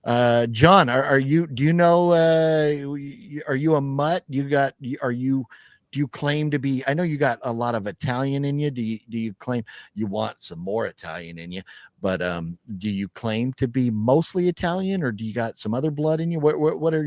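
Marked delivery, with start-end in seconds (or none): none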